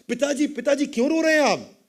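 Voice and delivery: high-pitched urgent question; alarmed son. Acted. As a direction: call out to father in acted alarm. Son runs up to weeping father.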